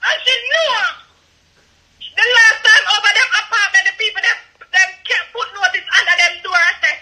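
breaking down in tears while yelling accusations; real tearful outburst. Real, not acted. Tearful caller on a livestream accuses Shami, crying and shouting. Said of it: "I know you're emotional".

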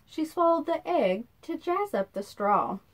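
ordinary delivery, nothing marked

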